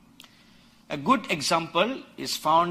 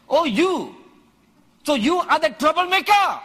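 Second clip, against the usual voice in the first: loud, higher, scornful challenge to Elijah; accusing Ahab; 10 semitones higher, 7 dB louder than usual. Retold or imitated. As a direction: fling the accusation at the prophet, louder and higher.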